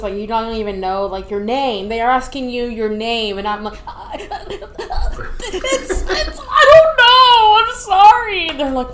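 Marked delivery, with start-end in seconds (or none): 3.8-4.8 high breathy nervous laugh. Retold or imitated. Laughing while retelling confusion. 4.8-8.5 high, loud, slow and flustered; flustered apology. Retold or imitated. Retelling her confused answer.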